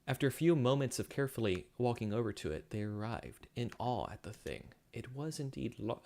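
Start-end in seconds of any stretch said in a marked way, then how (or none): none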